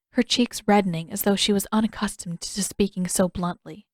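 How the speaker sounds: ordinary delivery, nothing marked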